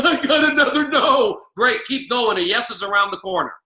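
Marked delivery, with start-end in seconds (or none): 0.0-1.4 louder, higher exasperated outburst; mock frustration. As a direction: huff at yet another rejection. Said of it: "Instead of getting frustrated".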